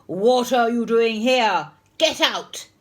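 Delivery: loud, angry demand; glowering teacher. A narrator's reading. Mister Nurden finding Henry in the room, angry bellow. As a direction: bellow it at the intruder. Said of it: "He saw Henry and glowered".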